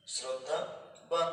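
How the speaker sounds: slow model word. Shown as a demonstration. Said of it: "হয়ে যাবে শ্রদ্ধাবান".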